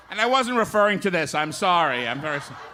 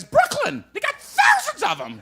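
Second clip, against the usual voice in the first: very high, loud parrot squawk; 7 dB louder than usual. Acted. Parrot answering the bartender, high loud squawk. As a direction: screech it out like a parrot. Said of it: "And the parrot says".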